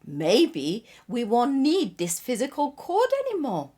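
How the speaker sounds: high, loud storybook insistence; insistent believer. A narrator's reading. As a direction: launch in high and push the hopeful argument. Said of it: "The second one insisted".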